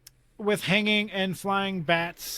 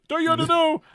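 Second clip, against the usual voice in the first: high-pitched mock-panicked mumble; hammed-up high, garbled aside; freaked-out mumble; 10 semitones higher, 4 dB louder, faster than usual. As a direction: imitate a flustered man running his words together. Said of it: "freaking out and starts mumbling".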